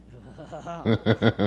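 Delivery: loud, breaking laugh; hearty laugh. Real, not acted. Owner amused at being outwitted by her dogs, hearty laugh. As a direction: burst out laughing.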